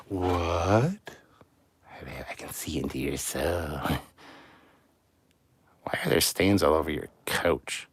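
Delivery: a hint of a leering stalker; mock creepy voice. Streamer teases a viewer by pretending to watch him through the screen. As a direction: peer into their soul, lightly creepy.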